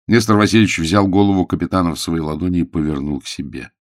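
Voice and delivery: plain reading voice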